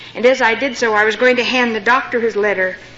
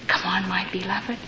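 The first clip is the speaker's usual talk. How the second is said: whispered, very soft; hushed, reverent whisper; tender whisper; 4 semitones lower, 9 dB quieter than usual. Retold or imitated. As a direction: whisper it as a loving invitation. God's tender answer to the child.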